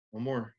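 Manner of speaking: breathy whispered sigh out, then a soft whisper; demo deep breath. Shown as a demonstration. Teacher leading class breathing, breathy exhale. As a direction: let the breath out through the mouth. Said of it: "breathe in through your nose".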